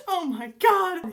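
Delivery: loud, high and drawn out; excited exclamation. Real, not acted. Reader reacting to the book mid-page, excited. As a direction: gush in stunned delight. Thrilled outburst while reading aloud.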